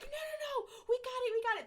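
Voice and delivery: very high, frantic imitation; excited mock insistence. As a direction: cut in high-pitched, imitating an overexcited crew. Crew excitedly refuse to leave.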